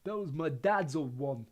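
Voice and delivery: slow, thick voice through a bloody nose; thickly. A narrator's reading. Neville, nose bleeding, mourns his broken wand, thickly. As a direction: speak as if your nose is bleeding. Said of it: "said Neville thickly".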